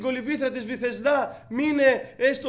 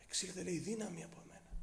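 much softer, lower, hushed; hushed words of the Lord; 6 semitones lower, 18 dB quieter, faster than usual. Acted. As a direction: let it fall to a hushed near-murmur. Lord speaks of power leaving him. (ο Κύριος λέει σιγανά ότι βγήκε δύναμη)

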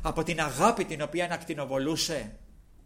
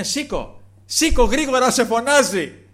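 much higher, much louder calling out; urgent call; 10 semitones higher, 12 dB louder than usual. Retold or imitated. Crowd tells the blind man to rise, loud urgent call. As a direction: hurry him up, shouting over the crowd.

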